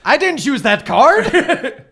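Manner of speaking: loud, slow protest through a laugh; object, half laughing. Real, not acted. Player protesting the game master's card mix-up, loud laughing objection.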